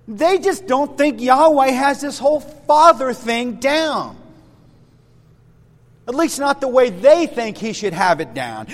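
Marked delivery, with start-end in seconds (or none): none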